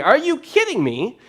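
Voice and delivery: high, loud exasperated shriek. Retold or imitated. Moses shrieking at another complaint letter, high exasperated yell. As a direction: throw up your hands and shriek it.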